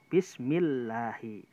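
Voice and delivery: Bismillahi drawn out slowly with a light, thin lam; thin lam recitation. Shown as a demonstration. Teacher reciting a thin lam example for the class, slow. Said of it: "seperti itu".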